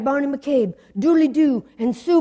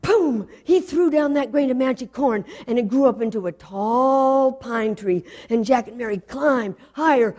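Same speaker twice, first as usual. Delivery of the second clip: ordinary delivery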